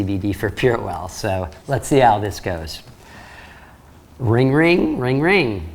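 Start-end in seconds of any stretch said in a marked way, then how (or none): 4.2-5.7 mock ringing phone, lifted and loud. Trainer voicing the ringing phone to start the call.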